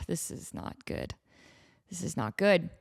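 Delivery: hushed, worried muttering; panicked whisper. Retold or imitated. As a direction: whisper it to yourself in dread. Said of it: "I'm just like".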